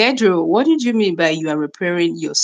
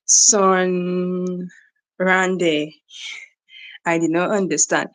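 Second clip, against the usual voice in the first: normal talking voice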